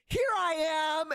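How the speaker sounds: spring out with arms wide; triumphant shout. Retold or imitated. Jumps out to announce himself.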